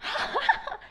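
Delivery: high-pitched laugh mimicking the wife; imitated hard laughter. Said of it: "and the wife said, laughing so hard more than him".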